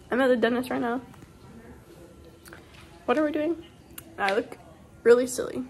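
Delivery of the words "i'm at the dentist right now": ordinary talk, nothing marked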